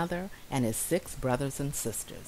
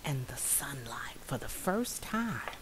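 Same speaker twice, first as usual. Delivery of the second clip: whisper; 2 semitones lower, 7 dB quieter than usual. A narrator's reading. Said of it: "story whisper".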